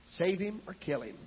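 soft, restrained plea to God; quiet desperate prayer. Retold or imitated. Desperate wife on her knees to God, quiet plea.

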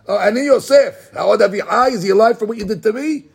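sarcastic rebuke. Acted.

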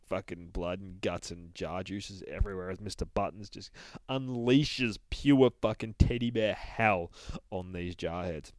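ordinary delivery

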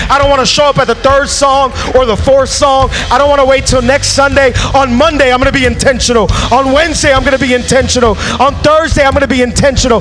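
loud, high, fervent preaching that keeps climbing; full-force preaching. Acted. Pastor urging the congregation to worship intentionally, full-force preaching. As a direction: preach it to the rafters, pushing harder each line.

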